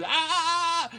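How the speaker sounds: loud, high mock scream; imitated scream. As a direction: scream out loud at your scarred face. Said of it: "and he screams".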